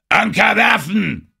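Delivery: high, loud, slow bellowed order; mighty roar. A narrator's reading. Captain orders the crew to drop anchor, mighty roar. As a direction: bellow the command with full force. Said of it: "brüllte Engelhorn mit mächtiger Stimme".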